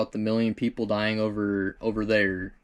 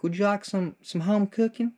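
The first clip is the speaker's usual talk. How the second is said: higher, sweet voice of the neighbour wife; sweet old lady; 9 semitones higher than usual. Retold or imitated. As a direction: offer it like a kind old lady. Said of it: "she's like".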